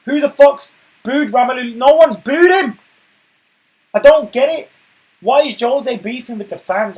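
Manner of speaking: real outrage over the booing claims; exasperated rant. Real, not acted. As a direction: rant at the pundits, fed up. Ranting about fans booing claim.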